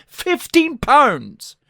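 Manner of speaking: slow, high, indignant cry of disbelief; outraged. Acted. Ranting comedian repeats the price in slow high disbelief.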